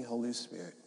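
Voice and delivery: ordinary delivery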